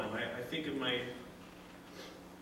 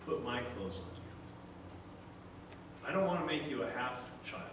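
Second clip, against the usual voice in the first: tender father; 4 semitones lower, 9 dB quieter than usual. Retold or imitated. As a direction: comfort a lost child gently. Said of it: "He says".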